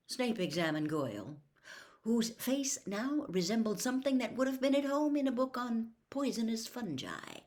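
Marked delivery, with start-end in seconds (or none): none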